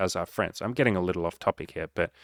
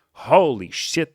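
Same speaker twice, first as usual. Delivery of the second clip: slow, high, drawn-out holy shit; mock shocked gasp; 6 semitones higher, 6 dB louder, much slower than usual. To viewers, feigning shock at an absurd belief, drawn out. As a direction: act stunned by the idea. Mock realization of own statement.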